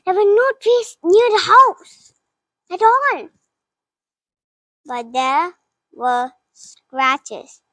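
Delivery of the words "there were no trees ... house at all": higher, louder spooked voice; shocked realization. Acted. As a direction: reveal it with rising alarm. Shocked discovery at the window.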